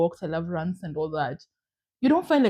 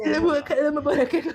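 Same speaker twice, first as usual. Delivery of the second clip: laughing mock; 7 semitones higher than usual. Mocking the tea cure.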